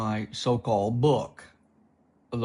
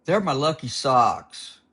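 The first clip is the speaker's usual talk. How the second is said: louder whiny protest; whining; 3 semitones higher, 7 dB louder than usual. Acted. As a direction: whine it like a sulky kid. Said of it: "he whined".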